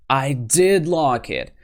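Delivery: DID drawn out and punched; emphatic DID. Shown as a demonstration. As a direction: insist slowly, leaning hard on DID. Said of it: "add an auxiliary verb to emphasize".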